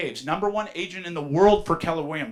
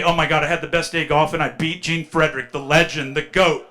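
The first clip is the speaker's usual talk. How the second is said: mock bragging; 10 dB louder than usual. Mock bragging golf quote.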